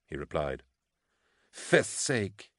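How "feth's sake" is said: exasperated snap, pitched high; snapping. A narrator's reading. Exhausted officer cursing at being told to rest, snapping. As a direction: snap it out in irritation. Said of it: "she snapped".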